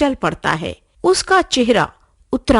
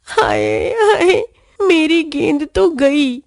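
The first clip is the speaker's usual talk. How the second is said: crying, breaking wail of loss; tearful wail; 5 semitones higher, slower than usual. A narrator's reading. Pappu wails tearfully as his ball is lost. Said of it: "रोनी सूरत बनाकर".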